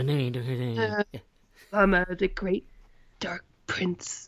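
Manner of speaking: low whispered cult leader; breathy whispered dark prophet. Retold or imitated. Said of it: "talking like this".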